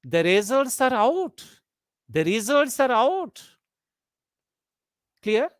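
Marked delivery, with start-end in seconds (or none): 0.0-3.4 questioning rise on "out"; statement as question. Shown as a demonstration. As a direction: pitch climbing up to "out". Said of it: "Statements intended to be a question".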